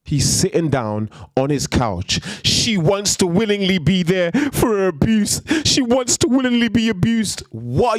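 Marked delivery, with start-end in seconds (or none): 2.5-7.4 mock incredulous voice. Mocking a quoted view.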